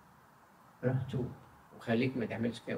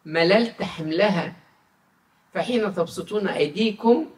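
slow, louder and raised reading of God's words; solemn scripture reading; 7 semitones higher, 8 dB louder, slower than usual. Acted. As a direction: proclaim the verse slowly, weightily. Reads Isaiah aloud in sermon. (قراءة كتابية مهيبة ببطء)

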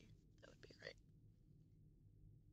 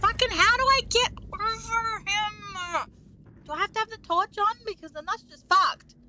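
frantic frustration; 10 semitones higher, 4 dB louder than usual. Real, not acted. Stuck in game, frustrated.

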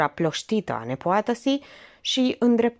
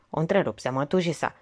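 usual speaking voice

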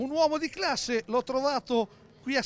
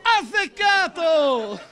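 laughing shout; 6 semitones higher, 8 dB louder, much slower than usual. Real, not acted. Host echoes the jingle, laughing.

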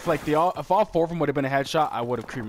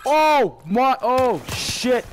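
much higher and louder, yelling oh over and over; real stunned excitement; 9 semitones higher, 7 dB louder, much slower than usual. Real, not acted. Gamer yelling in disbelief at a surprise multi-kill, real excitement. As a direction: shout in disbelief as the kills land.

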